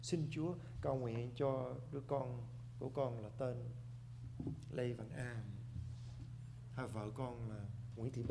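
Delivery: low, hushed, slowed prayer; sample prayer. Shown as a demonstration. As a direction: pray it softly and slowly, voice dropped. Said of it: "Chúa nghe cái lời cầu nguyện".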